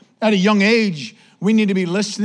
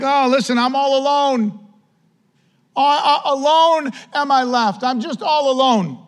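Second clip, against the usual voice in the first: boo-hoo whining; 7 semitones higher than usual. Acted. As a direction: play up the poor-me lament in a raised voice. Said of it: "Elijah goes into this boo-hoo thing".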